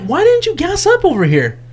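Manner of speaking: high disbelieving why; incredulous question. Retold or imitated. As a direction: sound baffled he skipped the pump. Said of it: "I'm like".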